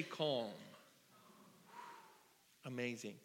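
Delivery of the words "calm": calm. Shown as a demonstration. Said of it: "Everybody say".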